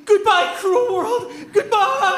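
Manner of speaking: laughing farewell. Acted. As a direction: laugh through a mock farewell. Caramel's melodramatic farewell.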